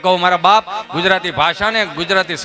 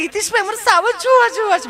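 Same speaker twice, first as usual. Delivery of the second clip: high, prissy mock city woman; much higher mock tourist lady; mimicking city lady; 13 semitones higher, 3 dB louder than usual. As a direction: put on a high, fussy tourist voice.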